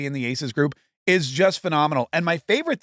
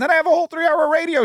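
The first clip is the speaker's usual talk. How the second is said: jazzed; 12 semitones higher, 3 dB louder, faster than usual. Real, not acted. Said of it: "that's why I'm so jazzed today".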